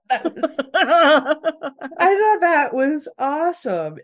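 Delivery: slow, high laughing delight. Real, not acted. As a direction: laugh through the words. Laughing through the line.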